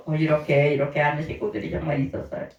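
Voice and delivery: normal talking voice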